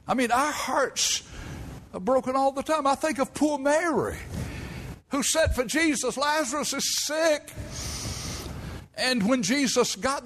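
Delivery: high, pleading sermon cadence; impassioned preaching. Acted. Old preacher pleading with his folks about tears, high and fervent. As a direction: carry the congregation up with you.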